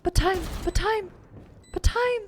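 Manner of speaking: urgent rising plea. Real, not acted. Urging during clutch.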